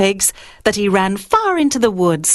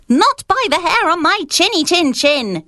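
higher, cheeky piglet refusal; little pig answering back, a bit higher; defiant little pig; 9 semitones higher than usual. Acted. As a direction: pig defying the wolf through the door.

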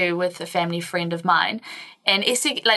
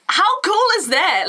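excited; 11 semitones higher, 4 dB louder, slower than usual. Real, not acted. Gushing about Spanish siestas.

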